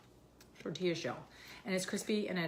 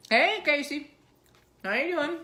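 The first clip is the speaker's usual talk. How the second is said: high, loud cheery hello; bright shouted welcome to a viewer; excited greeting; 11 semitones higher, 10 dB louder than usual. Real, not acted. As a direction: call out happily to a friend. Greeting friend joining live chat.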